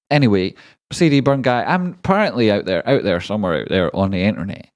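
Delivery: normal talking voice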